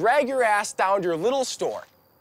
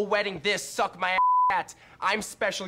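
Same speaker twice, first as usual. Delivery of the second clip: ordinary delivery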